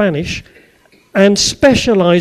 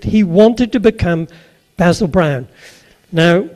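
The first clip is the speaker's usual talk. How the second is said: ordinary delivery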